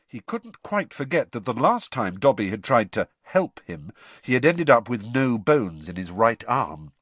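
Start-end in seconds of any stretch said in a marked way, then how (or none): none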